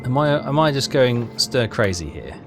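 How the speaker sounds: normal talking voice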